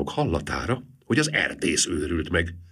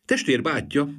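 ordinary delivery

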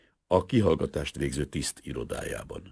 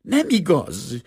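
slow, high, loud cry; screamed denial; 12 semitones higher, 6 dB louder, slower than usual. A narrator's reading. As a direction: shriek it out, drawing the words long. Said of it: "sikoltotta a szemüveges leány". (elnyújtott, sikoltó tiltakozás)

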